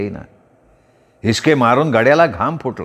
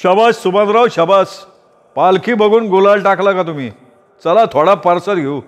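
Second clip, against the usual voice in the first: higher, booming patron slapping a back; hearty praise; 6 semitones higher than usual. A narrator's reading. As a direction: cheer him on like a proud host. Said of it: "त्याच्या पाठीवर थाप टाकत म्हणाला".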